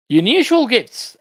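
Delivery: high, slow, announcing the title Unusual gifts; read-aloud heading. Acted. Teacher reads the lesson heading aloud to students.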